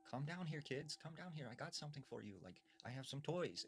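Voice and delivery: lightly voiced old woman tempting kids with toys; a hint of a sweet creepy grandma; coaxing old woman. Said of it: "this woman was like".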